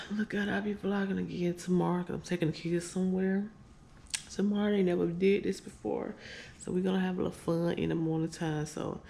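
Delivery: ordinary talk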